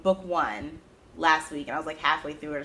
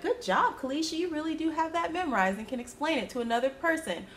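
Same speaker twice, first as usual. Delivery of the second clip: gushing praise; 9 semitones higher, faster than usual. Pretend. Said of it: "That could be really good".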